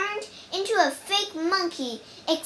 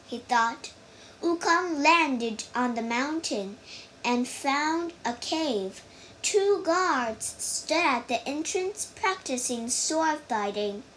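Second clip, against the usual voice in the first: plain reading voice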